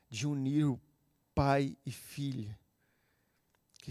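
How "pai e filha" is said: ordinary talk, nothing marked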